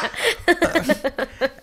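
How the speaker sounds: high-pitched burst of laughter; squealing happy laugh; giddy laugh. Real, not acted. Laughing at the memory.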